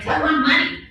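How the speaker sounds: high-pitched retold begging to pay; eager plea. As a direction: act out an eager, insistent offer. Retells pleading to pay mentor.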